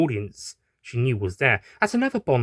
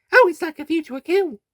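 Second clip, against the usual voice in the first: squeaky high cheerful voice, muffled; high doctor voice; 6 dB louder than usual. Acted. Doctor gagged in a lift, high and bright.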